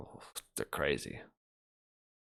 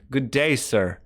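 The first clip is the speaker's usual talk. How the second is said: loud mock dismissal; 4 semitones higher, 8 dB louder, slower than usual.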